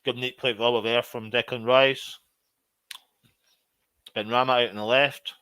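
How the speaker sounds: ordinary talk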